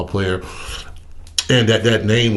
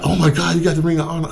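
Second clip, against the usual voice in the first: high, excited gasp of a question; a friend blown away by the news; friend's amazement; 8 semitones higher, faster than usual. Retold or imitated. Friend on the phone hearing the news, high excited surprise.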